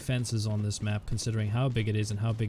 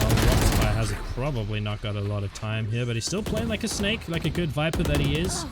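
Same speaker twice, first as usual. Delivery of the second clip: esports caster; 3 dB louder than usual. Real, not acted. Live play-by-play commentary.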